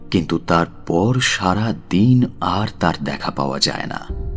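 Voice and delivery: ordinary delivery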